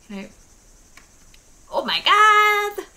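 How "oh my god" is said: high, loud, drawn-out cry; real startled shriek. Real, not acted. Player caught off guard mid-game, shrieking in surprise. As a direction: shriek it out, stretching the last word. Startled outburst while playing.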